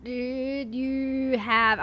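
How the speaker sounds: very slow, stretched-out words; slow-mo speech. Pretend. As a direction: drag each word like a slowed-down replay. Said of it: "trying to find things to say in slow-mo".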